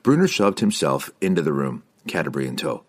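plain reading voice

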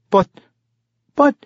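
halting, broken-off stammer. A narrator's reading. Young halfling caught out by the barkeep, stammering. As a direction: stall on the word, lost for a reply. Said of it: "Regis stammered".

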